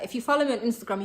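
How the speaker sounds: ordinary talk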